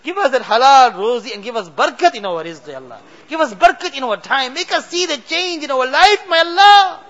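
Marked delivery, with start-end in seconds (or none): none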